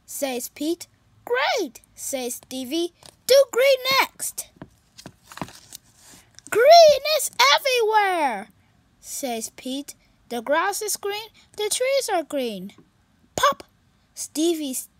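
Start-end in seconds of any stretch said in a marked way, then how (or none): 1.2-1.8 a touch higher, quick unicorn voice; lightly voiced sad unicorn. Stevie telling Pete about the party, lightly worried. 3.3-8.5 higher, louder eager voices. Acted. Friends racing to find colors, eager. 10.3-12.7 higher, louder bright cat voice; cheerful kitty voice. Acted. Pete greeting his sad friend, high and cheerful.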